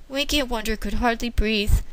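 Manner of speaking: plain reading voice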